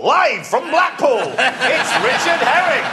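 high, slow, loud showbiz announcer; mock grand announcer, drawn out. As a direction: boom it out like a TV host intro. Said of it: "at that point I thought".

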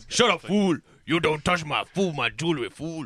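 hammed-up tough guy in a put-on accent; Mister T. Shown as a demonstration. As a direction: do a mock-tough accent, punching the opening. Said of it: "let me do my accent montage".

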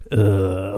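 low, drawn-out groan; imitated little groan. Shown as a demonstration. Demonstrating the absent co-host's noise, little groan. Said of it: "he gives that little groany".

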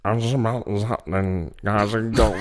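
lightly voiced comedian catchphrase; a hint of Tommy Cooper's growl; Tommy Cooper impression. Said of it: "I'm gonna do this impression now".

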